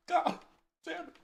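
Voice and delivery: normal talking voice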